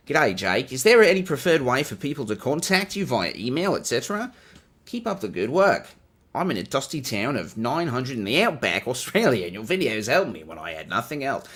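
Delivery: broad, hammed-up Australian with a swinging tune; cartoon Australian accent. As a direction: read it like a cartoon Aussie. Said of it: "I said that in a cartoon Australian voice".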